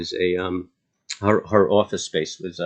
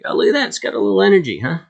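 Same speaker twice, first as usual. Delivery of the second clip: excited; 12 semitones higher, 6 dB louder, much faster than usual. Real, not acted. Stock suddenly moves on chart.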